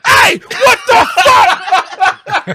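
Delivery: high, loud, drawn-out shout; guard's shout. Retold or imitated. Tour guide shouting at a boy who crossed the line, loud stretched yell. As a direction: yell it across the room at the kid.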